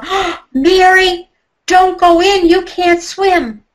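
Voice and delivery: high, loud and slow calling after her; yelling out. Retold or imitated. Doctor yells at Mary heading to the pool, a loud warning. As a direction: shout a warning across the yard. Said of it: "he yells out".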